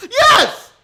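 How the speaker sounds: high, loud burst of yes; excited yes. Real, not acted. Storyteller answers a stunned listener with a high, loud excited yes. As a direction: blurt it out, thrilled. Emphatic reply to "What?.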